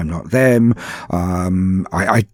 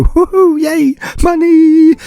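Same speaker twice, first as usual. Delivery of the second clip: high, loud whoop of delight; gleeful cheer; 7 dB louder, slower than usual. Retold or imitated. Freelancer reacting to a well-paid job offer, gleeful cheer. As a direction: cheer the payday, stretching out the last word.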